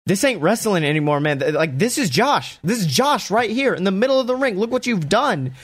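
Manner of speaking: high, pained, breaking accusation. Retold or imitated. Jimmy confronting Roman over beaten Jay, high pained voice. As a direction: confront him with what he did. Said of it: "stares at Roman and goes".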